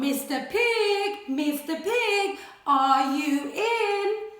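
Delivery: slow, drawn-out sing-song call. Acted. Wolf calling at the stick house door. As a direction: croon the name slowly at the door. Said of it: "I called".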